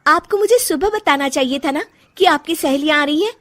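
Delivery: vexed daughter-in-law; irritated reproach. Acted. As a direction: let the resentment out at your mother-in-law. Daughter-in-law scolds mother-in-law.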